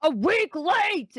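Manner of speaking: high, slow indignant yell; yelling dwarf. Acted. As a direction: bellow it out, outraged. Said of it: "he's, like, yelling at them".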